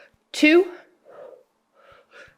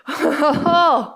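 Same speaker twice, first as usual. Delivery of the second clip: happy laugh; 7 semitones higher than usual. Real, not acted. Laughing after the cheer.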